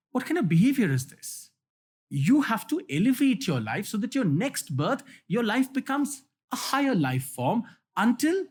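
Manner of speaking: high-pitched mock telling-off; retold angry scolding. Monk reprimanding a hissing king cobra, retold angry scolding. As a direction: scold like a storyteller's cross monk. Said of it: "got very angry and scolded the snake".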